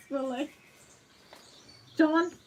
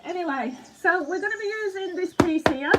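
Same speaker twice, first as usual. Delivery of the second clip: usual speaking voice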